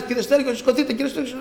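high-pitched nurse waking a patient; nurse calling out. Retold or imitated. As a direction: imitate a nurse calling someone awake. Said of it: "φωνάζει".

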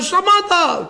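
high shouted command; acted crowd shout. Crowd tries to silence the blind man, high shouted rebuke. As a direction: yell it over a din. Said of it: "Κάλυπτε και τις άλλες τις φωνές".

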